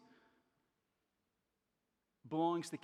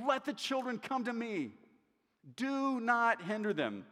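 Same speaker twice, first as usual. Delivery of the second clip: higher, firm, slowed rebuke; indignant; 5 semitones higher, 3 dB louder than usual. Retold or imitated. Jesus scolding disciples, indignant and firm. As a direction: rebuke them with indignant force. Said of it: "He's indignant. He's angry".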